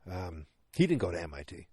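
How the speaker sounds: ordinary talk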